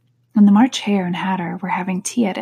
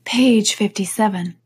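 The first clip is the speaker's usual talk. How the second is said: usual speaking voice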